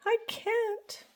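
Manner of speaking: high, soft and small; soft whisper. A narrator's reading. Franklin tells his friends he can't play, soft whisper. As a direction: say it barely aloud, ashamed. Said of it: "whispered Franklin".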